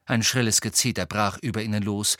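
ordinary delivery

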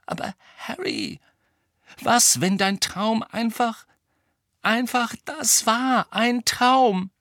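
high, slow, halting suggestion; hesitant doubt. A narrator's reading. Hermine doubting Harry's dream, hesitant and careful. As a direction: venture it cautiously, with pauses.

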